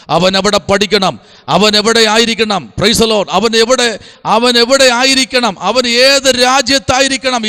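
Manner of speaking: rising, insistent sermon cadence; preaching, building. Real, not acted. Preacher urges parents how to pray, preaching with mounting force.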